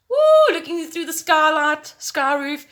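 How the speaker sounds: high, loud dreamy delight. Real, not acted. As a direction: swoon over the dream skylight. Daydreaming about a glass roof.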